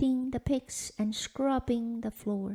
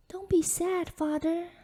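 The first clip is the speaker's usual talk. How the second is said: higher, gentle brave reassurance; brave comfort; 8 semitones higher, 3 dB louder than usual. A narrator's reading. Daughter soothing her father at the pine, bravely. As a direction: comfort him bravely, voice lifted. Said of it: "said Elena bravely".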